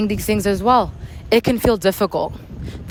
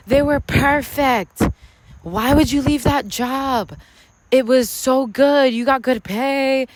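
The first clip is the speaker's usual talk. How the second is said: higher, slow, disbelieving voice of people who don't get it; imitating doubting people; 3 semitones higher, slower than usual. Mimicking people questioning why she left the job, higher and slow. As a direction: stretch it out, higher, as if baffled by her choices. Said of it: "people will literally project".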